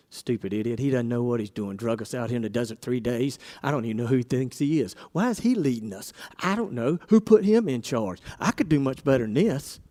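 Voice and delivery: low, quiet muttering complaint; grumbling. Retold or imitated. Preacher voicing grumbling Israelites, low and quiet. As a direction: grumble sourly under your breath. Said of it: "What does that sound like?".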